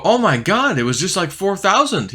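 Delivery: can't believe how far it fell; shocked disbelief. Retold or imitated. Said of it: "I go".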